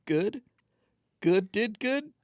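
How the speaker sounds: high, questioning little alien; broken alien voice. Acted. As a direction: chirp each word up like a curious creature. Alien speaking in broken words.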